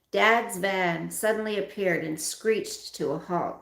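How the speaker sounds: plain reading voice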